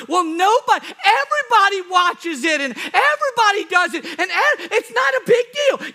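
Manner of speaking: high, loud objector's whine of excuses; mock protesting.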